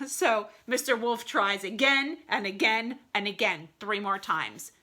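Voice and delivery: usual speaking voice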